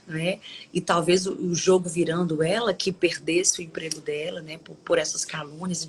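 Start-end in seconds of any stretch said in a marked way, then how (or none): none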